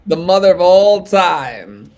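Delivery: loud, high, rising announcer call; big announcer voice. Acted. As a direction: belt it like a ring announcer. Repeats introduction bigger.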